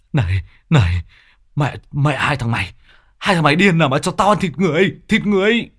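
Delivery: high, loud, fast panicked cursing; frantic furious yell. Acted. Mạnh cursing his two friends as they hold him, frantic. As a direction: rage at them for feeding you human flesh.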